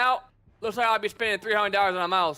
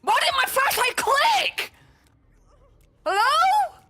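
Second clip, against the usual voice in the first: alarmed high call; 3 dB louder, much slower than usual. Real, not acted. Flashlight clicks unexpectedly, alarmed.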